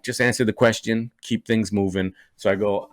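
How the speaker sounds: ordinary talk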